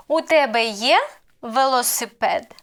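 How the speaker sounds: є swept upward, велосипед falling; question intonation. Shown as a demonstration. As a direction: ask it with a sharp lift on є. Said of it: "This is how we ask questions".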